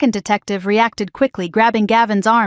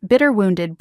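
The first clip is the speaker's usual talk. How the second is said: ordinary delivery, nothing marked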